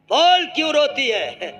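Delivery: scolding husband. Acted. Husband scolding crying wife.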